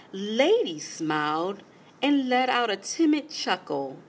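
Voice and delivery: plain reading voice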